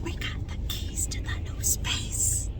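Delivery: soft unvoiced whisper. Real, not acted. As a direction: hush it like it's late. Whispering at night after gym.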